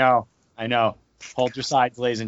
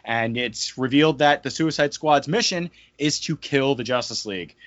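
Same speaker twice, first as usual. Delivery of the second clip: ordinary talk, nothing marked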